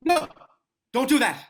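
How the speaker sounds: high, loud cry to stop her; panicked stop; alarmed shout. Acted. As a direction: yell out in alarm.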